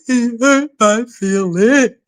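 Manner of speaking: mock immature whine. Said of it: "And I was really immature".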